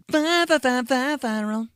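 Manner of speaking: high loud stuttering build to viral; hype stutter intro. Acted. Kicking off the viral segment with a hyped stutter. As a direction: stutter it like a DJ scratch.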